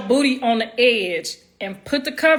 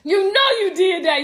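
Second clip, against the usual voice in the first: higher, teasing accusation; accusing; 9 semitones higher than usual. Retold or imitated. Wife blaming husband for the smell, high teasing. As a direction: pin it on him playfully. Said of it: "I tried to blame it on him".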